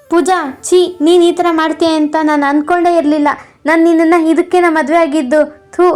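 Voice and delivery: usual speaking voice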